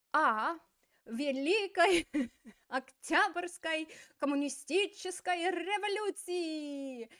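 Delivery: high, loud, slow proclamation, breaking into a laugh; grand Lenin voice. Acted. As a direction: trumpet it like Lenin, laughing midway. Teacher voices Lenin's answer.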